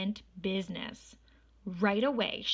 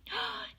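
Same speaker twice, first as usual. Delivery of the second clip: delighted gasp, very high; 4 dB quieter than usual. Acted. Miss Nelson returns.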